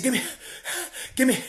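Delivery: high, loud, panting order at the counter; out of breath. Retold or imitated. Breathless at McDonald's ordering food for her. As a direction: blurt the order between gasps. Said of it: "I'm out of breath".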